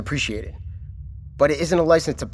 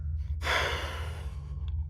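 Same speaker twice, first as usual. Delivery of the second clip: long, breathy exasperated sigh; heavy sigh of frustration; weary sigh; 13 dB quieter than usual. Acted. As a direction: let out a tired, impatient breath. Frustrated official pressing agent.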